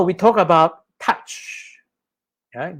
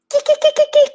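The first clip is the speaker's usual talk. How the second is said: very high, piercing imitated squeaks; repeated screechy violin squeaks; irritating high notes; 5 dB louder, faster than usual. Shown as a demonstration. Lecturer mimicking high erhu notes, irritatingly high. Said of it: "very high notes, it can be very irritating".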